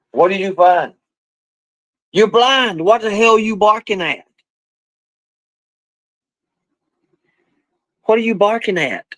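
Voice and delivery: high-pitched scolding, asking the dog what it barks at; high scolding dog talk. Real, not acted. Owner scolding the blind dog barking at nothing, high and exasperated.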